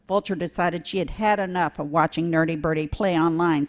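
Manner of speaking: plain reading voice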